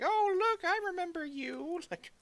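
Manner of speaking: very high, sing-song put-on mom. Son imitating mom joining his furry group, very high and sweet. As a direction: squeak it like a dainty Irish mom. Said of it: "doing that voice for her".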